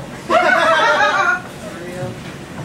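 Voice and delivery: short amused laugh at own title; a real chuckle before starting; laughing at own joke. Real, not acted.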